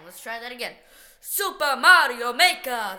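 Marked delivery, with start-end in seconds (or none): 1.2-3.0 loud, booming game announcer calling "Super Mario Maker". Shown as a demonstration. Announcing the game title like the in-game voice. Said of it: "sounds a lot more like what it sounds like in the game".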